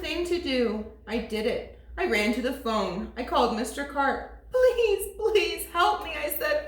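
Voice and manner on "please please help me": put-on pleading boy. Boy begging on phone.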